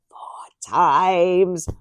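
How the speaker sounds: hushed whispered repeat; a whispered aside. Real, not acted. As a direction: breathe it under your breath. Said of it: "unvoiced whisper".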